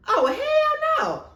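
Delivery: loud, very high drawn-out indignation; slow, shrieky over-the-top refusal; mock outrage. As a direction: wail it in hammed-up outrage. Mock outrage retelling the affair scene.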